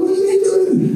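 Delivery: high, bewildered mock question; puzzled squeak of disbelief; high-pitched confused mutter; baffled inner voice. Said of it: "I was like".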